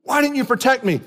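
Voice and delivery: imitating the son yelling for daddy; crying little boy. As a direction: cry out like a hurt little boy. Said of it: "start crying".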